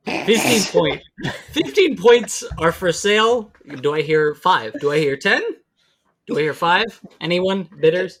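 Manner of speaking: loud, half-hearted auctioneer patter. Pretend. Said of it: "I did contemplate doing a auctioneer's impression".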